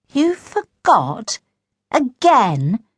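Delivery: high, slow incredulous questions; disbelieving. A narrator's reading. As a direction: draw it out in disbelief. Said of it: "looked at him disbelievingly".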